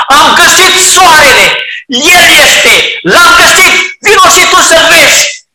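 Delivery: high, loud joyful shouting; boy calls his mother to come see; a child shouting with joy; excited shout. Acted.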